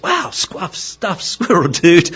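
very high, slow gushing dog voice; excited dog. Acted. Dog hears about stuffed squirrel dude, very high excited voice. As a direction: play a dog thrilled out of its mind. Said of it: "And so the dog says".

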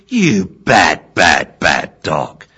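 loud, hammered scolding, word by word; scolding shout. Acted. Owner scolding her dog after the fight, shouting. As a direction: shout it slowly at a naughty dog. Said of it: "she grabbed me and shouted".

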